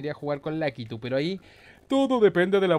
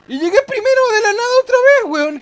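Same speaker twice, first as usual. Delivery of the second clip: very high, loud and fast, in disbelief; excited squeal; 12 dB louder, faster than usual. Real, not acted. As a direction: blurt it out racing, thrilled to be first. Suddenly takes first place.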